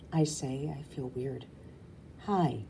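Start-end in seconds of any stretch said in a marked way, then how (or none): none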